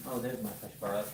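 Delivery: ordinary delivery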